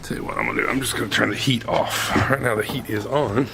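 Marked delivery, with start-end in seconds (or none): none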